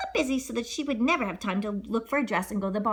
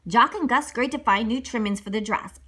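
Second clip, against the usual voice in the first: ordinary delivery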